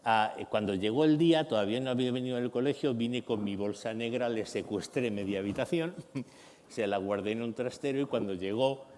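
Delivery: ordinary talk, nothing marked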